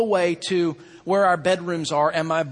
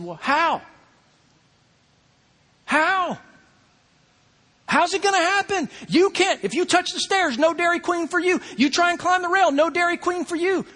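much higher, insistent mock-stern dad; teasing challenge; 7 semitones higher than usual. Father teasingly pressing his sons, much higher and insistent. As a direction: push the kids playfully, rising.

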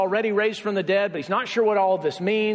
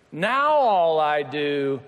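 long, slow swoop up on "now"; mock-dreary, dragged-out refrain; drawn-out lament; 4 semitones lower, much slower than usual. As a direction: stretch every word, sliding down after "now". Weary married refrain, drawn out.

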